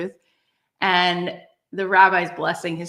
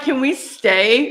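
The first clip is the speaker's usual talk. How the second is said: loud, high and slow plea; drawn-out eager begging; pleading; 6 semitones higher, 7 dB louder, slower than usual. Retold or imitated. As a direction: beg your friend not to leave. Begging her friend to stay.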